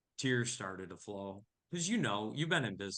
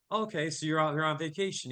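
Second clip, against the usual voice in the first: higher, louder, quick friendly guess; brisk assumption; 6 semitones higher, 6 dB louder, faster than usual. Retold or imitated. Chatting up a stranger on the mountain, quick and higher. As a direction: rattle it off, sure of the answer.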